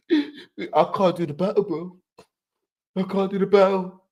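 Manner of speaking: put-on Chilla voice on the phone; imitating Chilla. Retelling Chilla's phone call, lightly imitated with a laugh. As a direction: play Chilla backing out of the battle, half laughing. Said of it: "And Chilla phone, yo".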